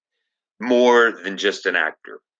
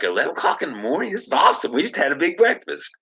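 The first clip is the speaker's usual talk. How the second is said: excited; 6 semitones higher, faster than usual. Retold or imitated. Delighted at morning snacks.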